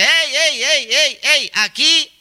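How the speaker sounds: high, repeated calls of hey; mock attention-getting shout. Preacher voicing the clinging dress as it shouts in the street, mock yelling. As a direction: call out like a dress clamouring for attention. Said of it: "grita a voz en cuello".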